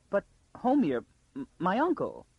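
high, stammered protest; vain protest. A narrator's reading. Girl protests for her uncle as she is dressed, vainly. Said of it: "she protested vainly".

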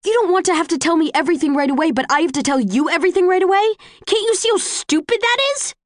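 fast, high-pitched angry rant; indignant snapping. A narrator's reading. Tris throws Tobias's double standard back, indignant and fast. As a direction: race through it, outraged and pitched high. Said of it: "I snap".

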